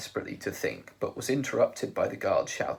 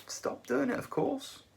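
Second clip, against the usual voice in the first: brightly but slowly; 3 dB quieter than usual. A narrator's reading. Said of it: "brightly but slowly".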